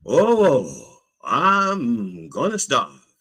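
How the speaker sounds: slow, drawn-out high lament; long-vowelled melodramatic moan; mock wail. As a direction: wail it slowly, hamming up the misery. Repeats complaint as a wail.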